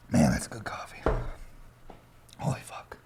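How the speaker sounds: hushed whisper of delight. Real, not acted. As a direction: breathe it out in a whisper. Savoring coffee, whispered.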